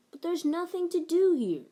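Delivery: sighing complaint. A narrator's reading. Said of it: "Little Bill sighed".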